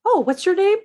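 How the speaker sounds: rising, lilting mock delivery voice, pitch climbing high; mock-cheery pizza man, voice jumping up; acted pizza delivery guy. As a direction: play the pushy pizza guy insisting. Acting out pizza scene.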